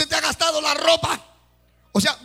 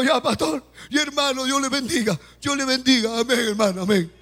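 lightly voiced sister. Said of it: "la hermana dijo".

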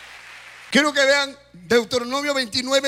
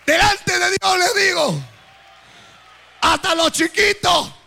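raised, higher preaching call; preaching shout; 5 semitones higher than usual. Real, not acted. Preacher exhorting congregation.

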